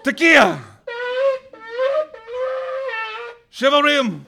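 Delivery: loud, high ceremonial shout; ringing, high-pitched proclamation; sharp blast call. A narrator's reading. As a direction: call out each shofar blast like a command. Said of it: "I heard a sharp blast".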